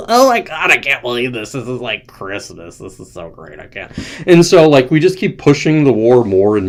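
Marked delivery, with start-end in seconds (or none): 0.1-3.9 mock glee. Russia and China delighted at the windfall, mock gleeful. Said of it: "Russia and China was, are like".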